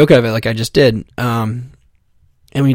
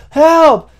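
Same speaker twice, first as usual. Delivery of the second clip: very high, loud yell; desperate high call for help; frantic cry for help; 6 dB louder than usual. Retold or imitated. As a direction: scream like your child was stolen. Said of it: "I was like".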